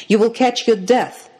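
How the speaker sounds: high, loud exclamation of reproach; alarmed scolding. A narrator's reading. Twins pull shivering sister by the hands, alarmed scolding.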